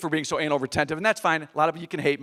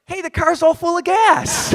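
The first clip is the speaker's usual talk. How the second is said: much higher, slow, wide-eyed mock voice; baffled wife; 11 semitones higher, 4 dB louder, slower than usual. Wife amazed the car is full, slow and wide-eyed. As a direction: drag it out in innocent wonder. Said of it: "She's always like".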